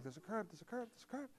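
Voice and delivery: very soft half-asleep mumble; sleepy under-the-breath muttering; talking to himself. Retold or imitated. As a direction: mumble it to yourself, barely audible. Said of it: "I'm talking to myself".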